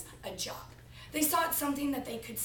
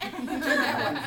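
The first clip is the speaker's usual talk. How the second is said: laughing; 4 semitones higher, 7 dB louder than usual. Real, not acted. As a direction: repeat the line through real laughter, louder. Repeats line through laughter.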